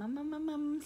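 high "um-um-um" filler; searching-for-the-word hum; thinking hum. Real, not acted. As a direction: stutter out high placeholder ums while recalling. Filling time while recalling the day.

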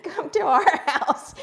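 loud, high invitation through laughter; real laughter bubbling through the words; laughing. Real, not acted. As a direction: joke while still cracking up. Cracking up over the name mix-up.

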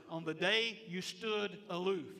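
verse drawn out slowly, each word weighed; slow emphatic reading. Shown as a demonstration. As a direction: read it slowly and deliberately, like Scripture. Reads verse slowly, then rereads.

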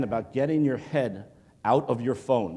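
usual speaking voice

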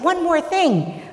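high, drawn-out call; crying out. Acted. Jesus calls after the running man, crying out. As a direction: call after someone, high, loud and slow. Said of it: "And Jesus cries out".

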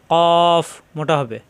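কফ said thick and heavy, slow; heavy qaf. Shown as a demonstration. Teacher stressing a heavy letter to students, slow and thick. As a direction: draw out a heavy কফ.

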